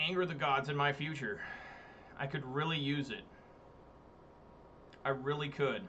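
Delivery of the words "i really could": ordinary delivery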